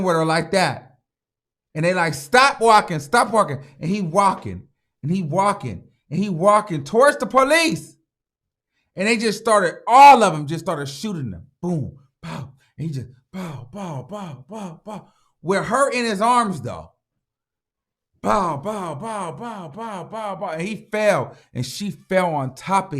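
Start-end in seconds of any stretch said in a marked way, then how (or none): 2.1-3.6 raised, higher-pitched mock order; imitated police command. Acts out police ordering him to stop. 11.6-12.5 soft spoken gunfire noises; imitated gunshots. 13.3-15.1 hushed, rhythmic gunfire imitation; imitated gunshots. 18.2-20.5 higher, soft volley of mock gunfire; imitated gunshots.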